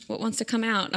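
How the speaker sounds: usual speaking voice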